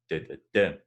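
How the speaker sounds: last da hit hardest; stress rhythm. Shown as a demonstration. Tutor chanting Aberdeen with stress on the last beat. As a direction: tap it out with the weight at the end. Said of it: "So that's Aberdeen".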